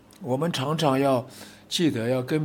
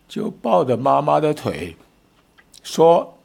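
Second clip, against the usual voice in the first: ordinary talk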